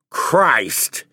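a sudden loud oath, high; loud exclamation. Acted. Fisherman staring at the empty shelf, loud exclamation. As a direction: blurt it out loud, stunned. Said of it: "he exclaimed loudly".

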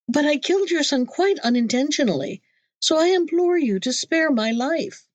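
high-pitched, imploring voice; desperate pleading. A narrator's reading. Merchant implores the genie to spare him, desperately pleading. Merchant begs for his life.

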